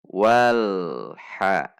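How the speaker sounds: loud "wal" with a steady l leading straight into a short "ha"; correct joined lam. Shown as a demonstration. Teacher models the right reading, l joined to ha. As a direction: recite "wal ha", l neither bounced nor dragged. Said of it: "Namun bainiyah".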